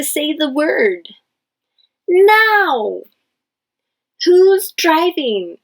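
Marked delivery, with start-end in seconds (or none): 2.1-3.0 now much higher and louder; loud excited now; emotional shout. Shown as a demonstration. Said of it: "exclamation marks for emotion". 4.2-5.6 higher, slow asking; higher who's driving; lively question. Shown as a demonstration. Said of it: "this is a question".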